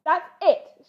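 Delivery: furious father bear shout. Acted. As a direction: snap at the cubs with a shout. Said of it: "shouted Mister Bear".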